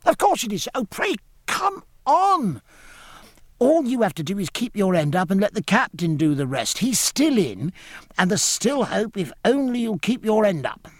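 put-on high, urgent boy's voice; high-pitched, insistent youngster; impatient child. A narrator's reading. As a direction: urge and encourage like an eager lad. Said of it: "cried the child again".

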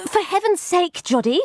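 high, impatient order; exasperated instruction. A narrator's reading. Employer instructing startled boy from her desk, exasperated. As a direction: pitch it up, chivvying a servant. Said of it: "I instructed that startled boy".

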